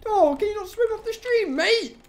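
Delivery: high, sing-song mock concern; mocking taunt. Real, not acted. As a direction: rub it in, teasing. Mocking fleeing animal.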